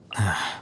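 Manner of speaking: soft tired sigh, breath falling away; weary sigh. Acted. The scribe sighing at a rainy day, soft sigh. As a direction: let out a bored, tired sigh.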